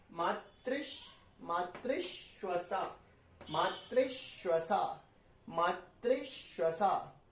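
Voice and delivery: मातृश्वसा slowly, री sliding straight into श्व; slow model reading. Shown as a demonstration. Teacher modelling मातृश्वसा slowly for students. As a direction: run री into श inside मातृश्वसा, unhurried. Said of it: "री बोलते के साथ ही श बोलेंगे".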